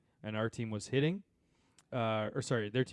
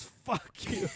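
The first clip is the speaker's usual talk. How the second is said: hissed swear; 10 semitones higher, 5 dB quieter than usual. Retold or imitated. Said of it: "just turns to the guy and goes".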